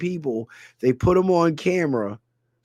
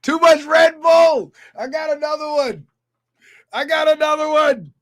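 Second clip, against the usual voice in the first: hyped shouting; 8 semitones higher, 5 dB louder than usual. Pretend. Hyped on too much Red Bull, high holler. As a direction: holler it high on one note.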